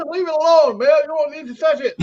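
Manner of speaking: high, loud mock Chinese accent. Chinese doctor lecturing the patient about American surgery, in a high mock Chinese accent.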